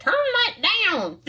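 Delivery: high, slow mock yell of a grumpy neighbour; mock angry neighbour. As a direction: play the grouchy neighbour shouting over the music. Angry neighbours yelling at teens.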